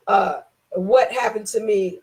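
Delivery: normal talking voice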